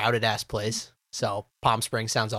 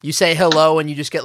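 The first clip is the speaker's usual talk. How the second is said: ordinary delivery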